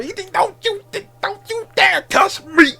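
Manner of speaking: high mocking squeal; squeaky outraged impression; Paul Heyman voice. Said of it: "Paul Heyman was like".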